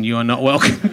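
low, dry pretend rebuke with welcome rising; mock stern. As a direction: play the stern doorman as a joke. Mock banishing the banker.

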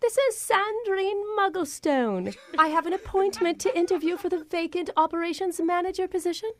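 mock-formal British accent; fake British accent. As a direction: put on a grand British job applicant.

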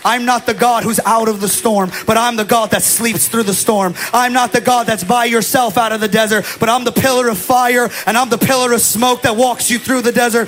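loud, driving declaration in God's voice; preaching as God's voice. Acted. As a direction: proclaim God's promise with full force. Pastor voices God's message.

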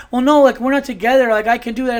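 high, loud mock protest; loud, whiny-innocent self-defence, mocking her; playing the victim. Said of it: "to all of a sudden play the victim".

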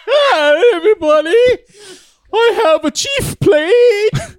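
shrill, loud, laughing party-crasher voice; Diane voice. Acted. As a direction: burst in high and loud, slowly. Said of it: "Oh, no, Diane's coming".

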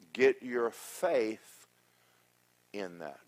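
drawn out, each word weighted; slow emphatic exhortation. Real, not acted. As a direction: lay it on them slowly.

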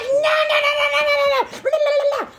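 very high mock shriek, rapid-fire; hammed-up hysterical tantrum of protest; flipping out. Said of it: "Clara Sue flips the fuck out".

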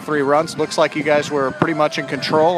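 upbeat post-game host voice; bright on-field announcer delivery; sports broadcaster. Acted. Post-game play-by-play host wrap.

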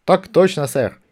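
loud clipped soldier's reply; corporal snapping to attention. Acted. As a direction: bark it out like a drilled corporal. Corporal answers Vimes smartly. (по-солдатски чётко, громко)

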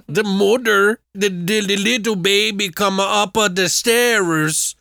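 high, slow mock Swedish; bad Swedish accent. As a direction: draw it out in a silly Swedish accent. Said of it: "has the worst Swedish accent in the world".

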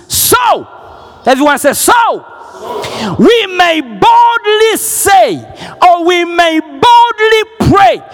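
ringing bold prayer; full-force preaching. Real, not acted. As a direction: boldly declare it to the church.

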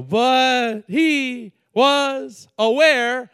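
said very slowly. Shown as a demonstration. Preacher slowing down for emphasis, very slow. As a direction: drag out every word of "But he was aware". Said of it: "I'm gonna say that slow".

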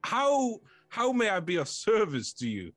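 high, eager, lonely voice; a lonely fort gushing over its first guests; talking fort. Acted. Lonely fort welcomes the party, high and eager.

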